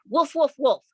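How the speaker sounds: small dog woofs, high and loud; high yappy bark. Acted. Little dog barks at the family, high and loud. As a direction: yap like a tiny dog.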